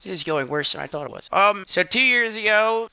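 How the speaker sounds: ordinary delivery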